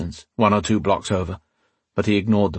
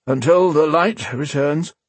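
put-on slow, higher frail old father; 7 semitones higher, slower than usual. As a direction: echo it slowly like a tired old man. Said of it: "he said".

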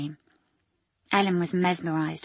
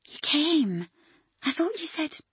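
surprised; 9 semitones higher than usual. Acted. Ellen surprised to see Dougie.

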